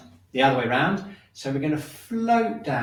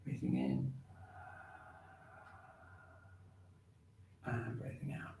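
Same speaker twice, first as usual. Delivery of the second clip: guided breathing cues fading to a whisper; 2 semitones lower, 12 dB quieter than usual. Acted. Instructor guiding class breath by breath, fading softly. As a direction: let the cues sink into a hush.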